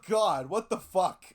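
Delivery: usual speaking voice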